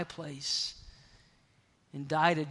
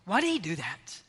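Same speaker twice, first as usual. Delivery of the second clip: usual speaking voice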